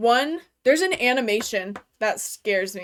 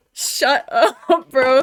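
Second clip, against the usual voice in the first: louder, higher, laughing through shut up bro; 3 semitones higher, 9 dB louder, much slower than usual. Retold or imitated. Teasing dad about his Greek, laughing shut up. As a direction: break into a laugh mid-protest. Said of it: "And I'm like".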